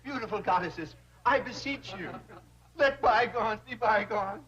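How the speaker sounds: mock-humble groveling to the girls; groveling plea. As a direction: lay it on thick, pleading for mercy. Banker grovels to offended secretaries.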